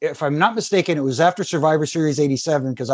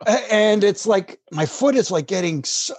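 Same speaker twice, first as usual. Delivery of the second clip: normal talking voice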